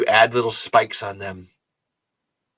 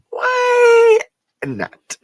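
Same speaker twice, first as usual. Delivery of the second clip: mock high stretched why not; 6 dB louder than usual. Shown as a demonstration.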